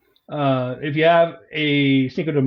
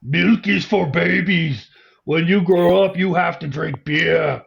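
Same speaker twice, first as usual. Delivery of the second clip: slow, lightly Austrian-flavored tough-guy read; a clumsy Schwarzenegger impression, drawn out; bad Arnold Schwarzenegger accent; 3 semitones higher, slower than usual. Podcast host hams a bad Schwarzenegger accent for his co-host. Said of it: "I'll do the accent anyway".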